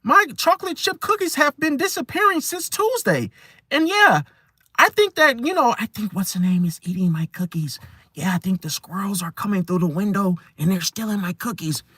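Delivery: high, rambling nervous voice; over-explaining. Retold or imitated. Nervous person over-explaining missing cookies in a high voice. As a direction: babble on, explaining too much. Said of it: "over-explaining basic things".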